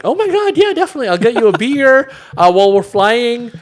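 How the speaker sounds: high, breathless swooning flight attendant. Retold or imitated. Attendant gushing at the captain, a high swooning voice. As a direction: gush like a smitten flight attendant. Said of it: "the flight attendant was like".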